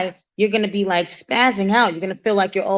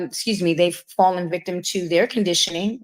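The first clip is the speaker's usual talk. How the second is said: ordinary talk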